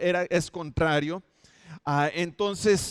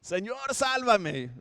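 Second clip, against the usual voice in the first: much higher, desperate cry for help; Peter's cry; 8 semitones higher, much slower than usual. Retold or imitated. Simón cries to the Lord while sinking, high desperate cry. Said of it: "cuando grita Simón".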